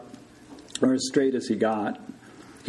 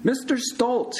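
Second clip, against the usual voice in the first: high-pitched raised call to a patient; shout; 12 semitones higher than usual. A narrator's reading. Morris to sobbing patient under the bedsheet, shouting. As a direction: call out to someone hidden under covers. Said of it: "he shouted".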